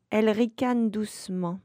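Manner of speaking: plain reading voice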